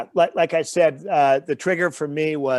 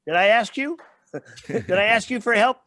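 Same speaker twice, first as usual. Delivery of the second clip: mock indignant retort; 6 semitones higher than usual. Retorting to unsolicited advice.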